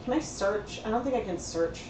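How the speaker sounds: ordinary delivery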